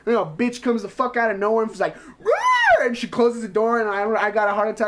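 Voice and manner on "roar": sudden roar, much higher and louder; jump-scare shriek-roar; imitating the ghost lunging out with a roar; monster roar. Ghost jumps out of door.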